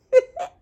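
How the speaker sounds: quick high squeal of a laugh; a bright burst of giggling; high giggle. Real, not acted. Vlogger giggling before naming her YouTube channel.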